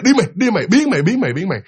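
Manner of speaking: high angry shout, shooing. Acted. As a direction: chase him out with a yell. Woman chasing spirit again.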